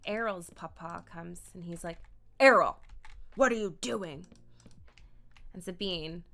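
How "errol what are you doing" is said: loud paternal scolding; loud, disapproving demand; stern father. Acted. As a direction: come down hard on the name. Papa confronts his son.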